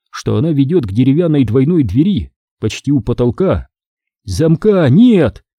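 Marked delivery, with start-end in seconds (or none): 4.2-5.5 slow, higher, hopeful exclamation. A narrator's reading. Greg telling friends the door is unlocked, hopeful. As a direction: announce it slowly with rising hope. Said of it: "с надеждой сказал Грег".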